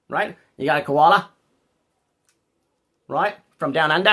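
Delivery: mock Aussie accent; Australian accent. Streamer showing off to an Australian viewer, Australian accent. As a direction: put on a teasing Australian twang. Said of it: "does it sound like I have the accent".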